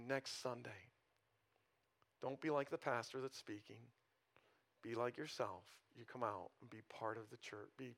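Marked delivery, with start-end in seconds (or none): none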